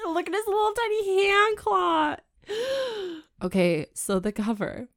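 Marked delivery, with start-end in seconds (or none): 0.1-3.2 high, whiny disgusted cry; disgusted wail. Real, not acted. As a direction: whine it out in mock horror at the tiny claw. Disgust at tiny claw.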